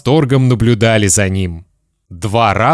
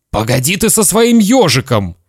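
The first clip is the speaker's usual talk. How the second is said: higher, edgy father; nervous irritation; 7 semitones higher than usual. Acted. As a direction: brush the child off nervously. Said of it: "нервно сказал папа".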